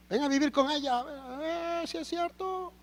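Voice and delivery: high-pitched, whining dare; mock complaining challenge. Husband daring the Lord to live with his wife, mock complaining.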